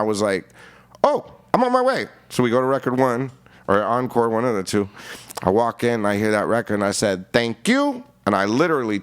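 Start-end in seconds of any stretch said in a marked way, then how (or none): 1.0-2.1 high-pitched, excited "on my way"; retold eager reply. Answering [name]'s call about the single, imitated eagerness, pitched high. 7.3-8.0 high, singsong thank-you; retold cheeky thanks. Taking the record out of [name]'s hand, high mock-polite thanks.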